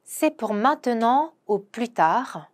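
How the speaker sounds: very slow, careful French; slow pronunciation model; slow demo. Shown as a demonstration. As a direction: lay out each syllable slowly for learners. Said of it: "요거 한번 발음해 보도록 하겠습니다".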